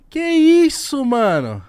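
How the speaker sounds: high, loud, shocked laughing. Real, not acted. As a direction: cry out in disbelief. Shocked reaction to needle trick.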